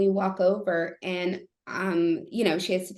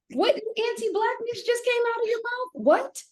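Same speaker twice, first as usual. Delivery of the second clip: much higher, appalled challenge; outraged disbelief. Retold or imitated. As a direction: call him out in shocked outrage. Outraged at husband's remark.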